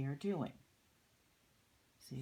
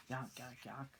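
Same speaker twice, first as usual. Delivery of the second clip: ordinary delivery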